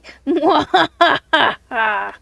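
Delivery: hammy villain cackle; evil laugh. Pretend. Villain laugh after the threat.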